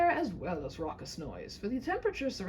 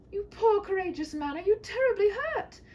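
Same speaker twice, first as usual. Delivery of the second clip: high, louder princess crying out in alarm; worried princess; 12 semitones higher, 7 dB louder than usual. Acted. Princess rushes to bloodied knight, high alarmed cry. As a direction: rush to him, anxious and high.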